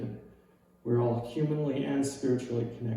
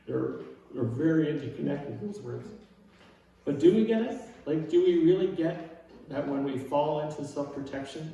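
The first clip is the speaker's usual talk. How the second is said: normal talking voice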